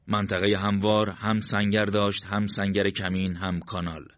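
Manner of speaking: usual speaking voice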